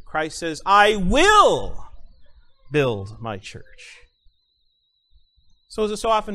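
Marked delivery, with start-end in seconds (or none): 0.7-4.0 "I will" loud and raised, "build my church" slow; stress on WILL. Shown as a demonstration. Pastor to congregation, moving stress to "will". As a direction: press on "will". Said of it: "let's move to the next word".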